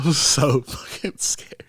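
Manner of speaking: ordinary talk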